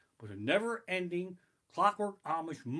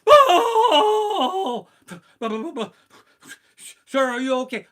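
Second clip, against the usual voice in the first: high, loud, crying shout and stutter; terrified stammer; 13 semitones higher, 15 dB louder, slower than usual. Retold or imitated. In line for a snow cone, screaming and stammering. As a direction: stammer through a screaming fright. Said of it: "I'm like".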